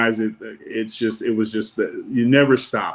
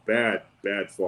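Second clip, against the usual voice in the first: ordinary talk, nothing marked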